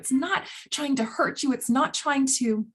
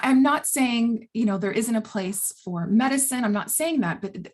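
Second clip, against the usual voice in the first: usual speaking voice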